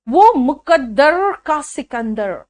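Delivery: slow वो मुकद्दर का सिकंदर with a curled English r; mock English r. Teacher mocks singer's English accent in Hindi song. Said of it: "English का जो आर था वहां घुसा दिया".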